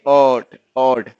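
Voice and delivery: ऑड drawn out slowly and loud; slow ऑड demo. Shown as a demonstration. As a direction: stretch ऑड for the class. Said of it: "इसमें क्या sound आ रही?".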